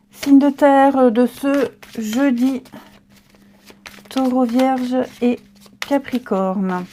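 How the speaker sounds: slow, mock English accent on French words; lightly done English-accented French; bad English accent. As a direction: put on a clumsy English accent. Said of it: "mon accent anglais pourri".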